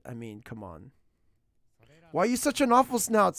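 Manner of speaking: ordinary delivery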